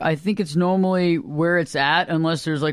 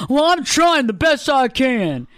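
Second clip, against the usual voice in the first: high, drawn-out imitation of an excuse-maker; mock protesting; 6 semitones higher, 3 dB louder, slower than usual. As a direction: mimic someone pleading effort, slow and raised. Mimics people making excuses.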